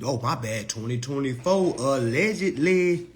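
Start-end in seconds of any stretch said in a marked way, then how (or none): 1.8-3.1 drawn-out, higher, louder hedge; winking hedge. Real, not acted. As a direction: stretch it out with a knowing wink.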